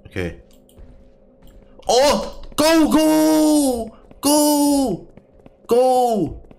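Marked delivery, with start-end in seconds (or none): 1.9-6.5 high, loud terrified shouts; panicked screaming. Real, not acted. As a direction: scream it in sheer terror. Monster attack, screaming go.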